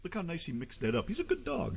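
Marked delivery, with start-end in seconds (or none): 1.1-1.8 doggy praise voice. Real, not acted. Praising the dog.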